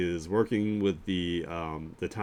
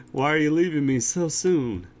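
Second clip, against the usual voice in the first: higher, playfully wounded cry; mock plea; 7 semitones higher, 4 dB louder than usual. Streamer jokingly begging a dinosaur to stay, mock pleading. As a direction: pretend to plead, pitched up high.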